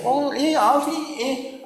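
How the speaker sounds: high, hesitant tourist babble; mock confused tourists. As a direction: play a lost foreigner fumbling for words. Mimicking lost foreign tourists.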